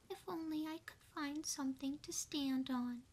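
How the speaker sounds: small mouse voice. Acted. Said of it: "she said".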